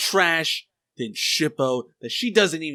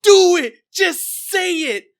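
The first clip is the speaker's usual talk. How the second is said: much higher, loud begging yell; desperate shout; 13 semitones higher, 8 dB louder than usual. Retold or imitated. Inuyasha begging Kagome to say sit, desperate yell. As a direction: beg her to say it, full volume. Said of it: "Inuyasha's like".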